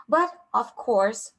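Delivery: usual speaking voice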